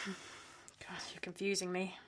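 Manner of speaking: weary sighing whisper. Real, not acted. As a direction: breathe it out, worn down. Sighing, exhausted wife.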